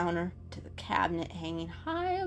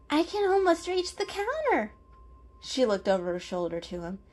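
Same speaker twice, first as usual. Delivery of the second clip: put-on higher, friendly girl cat voice; 10 semitones higher, 4 dB louder than usual.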